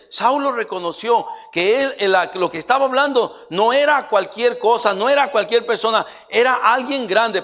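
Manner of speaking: high, loud emphatic sermon; loud preacher driving the point home; full-force preaching. Real, not acted. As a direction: cry out to the congregation with rising force.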